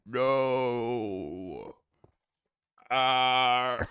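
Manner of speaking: long drawn-out villain cry; mock agonised howl; Darth Vader. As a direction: stretch it into a hammed-up wail. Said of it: "Do an impression of Darth Vader".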